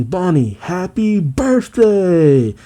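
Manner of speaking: lightly acted group yell; mock crowd yell. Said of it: "with everyone yelling".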